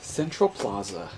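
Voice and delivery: ordinary talk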